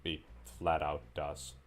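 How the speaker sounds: ordinary delivery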